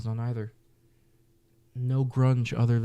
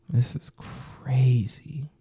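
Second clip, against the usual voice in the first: slow, stunned whisper of disbelief; much slower than usual. Real, not acted. Reacting to the album list, a slow stunned whisper. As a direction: breathe it out slowly in shock.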